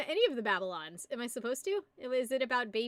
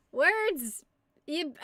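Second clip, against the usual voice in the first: usual speaking voice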